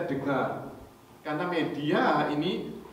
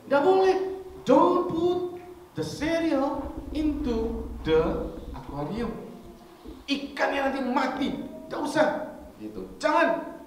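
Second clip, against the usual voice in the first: scolding mother; 10 semitones higher than usual. Retold or imitated. Mother scolds child in English.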